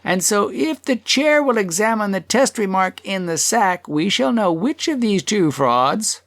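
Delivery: high, declaiming speaker; tanner making his case. A narrator's reading. Tanner puts his case to the chair, high oratory.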